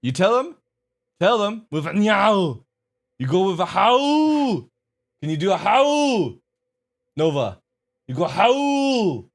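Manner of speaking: high, loud, drawn-out coaxing voice; different voice for the dog. Real, not acted. Owner urging his husky to howl, high excited coaxing. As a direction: egg the dog on, swooping up into howls. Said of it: "asking him in a different voice".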